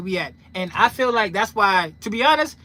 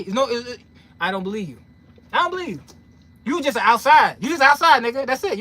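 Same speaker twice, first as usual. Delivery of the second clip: ordinary talk, nothing marked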